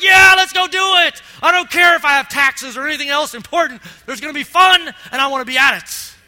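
loud, high, eager shout; excited yell. Retold or imitated. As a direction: cheer like nothing else matters but the party. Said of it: "Every I in here be like".

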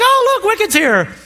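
high, bubbly excited wife voice. Retold or imitated. Pastor imitates his wife spotting the Wicked billboard, high and thrilled. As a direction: squeal it like a thrilled fan.

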